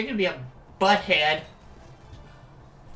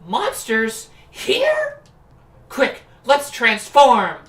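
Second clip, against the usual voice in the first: put-on bright magical girl; 5 semitones higher, 5 dB louder, much slower than usual. Characters spot monsters.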